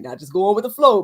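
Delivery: usual speaking voice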